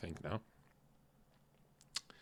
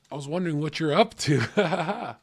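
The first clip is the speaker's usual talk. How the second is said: louder and higher, bursting out the line; 6 semitones higher, 10 dB louder than usual. Retold or imitated. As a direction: blurt it out, higher and louder, too eager. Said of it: "They go like this".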